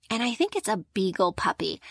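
normal talking voice

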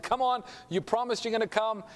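high, insistent mock plea; acted pleading. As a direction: imitate a brother holding someone to a promise. Brother holds him to promise.

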